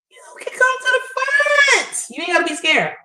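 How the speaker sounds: calling out. Real, not acted. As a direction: call out to the lurkers. Calling lurkers to the front.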